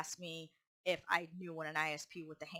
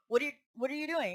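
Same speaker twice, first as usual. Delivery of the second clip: quick, higher-pitched demanding question; not calm; 6 semitones higher, 3 dB louder, faster than usual. Retold or imitated. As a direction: imitate a boss confronting an employee abruptly. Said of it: "It wasn't calm".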